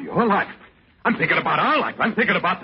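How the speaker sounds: angry. Acted. Husband confronts wife at dawn.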